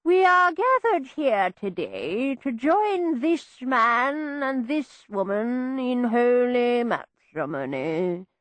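slow, solemn ceremonial drone; droning vicar. A narrator's reading. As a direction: drone the wedding rite. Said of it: "the vicar's drone".

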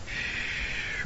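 soft, breath-only shush; long, quiet shh to calm him; hushing shh. A narrator's reading. Alarmed mother rocking her son, hushing.

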